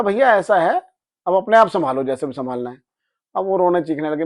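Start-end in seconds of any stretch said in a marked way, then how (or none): 0.0-2.8 loud, high angry brush-off; angry dismissal. Retold or imitated. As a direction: cut them off in anger. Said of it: "मुझे बहुत तेज गुस्सा आ गया". (गुस्से में तेज़ आवाज़ से झिड़कना)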